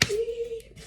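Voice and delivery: high-pitched vocal beep; imitated bus horn. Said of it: "The bus was like".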